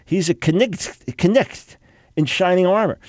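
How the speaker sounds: knecht with a sounded k and a ch; playful old-German knecht. Shown as a demonstration. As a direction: say knecht with the k and a throaty ch. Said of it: "where it was pronounced knecht".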